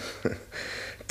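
a quick, almost silent breathy laugh; short soft breathy laugh. Acted. Accused man laughing softly at his own guilt.

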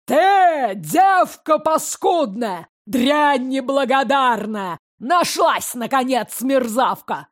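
furious shout. Acted. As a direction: bellow it in savage fury. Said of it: "зычный крик, полный свирепой ярости".

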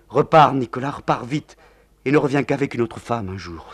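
put-on young woman urging her lover away; urgent plea. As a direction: beg him to leave. Nathalie sends Nicolas away.

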